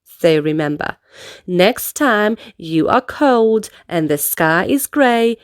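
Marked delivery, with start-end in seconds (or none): none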